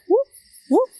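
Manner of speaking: short high whooping call; imitated whoop. Caver imitating a whoop heard in the distance, high whooping. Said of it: "some kind of whooping noise".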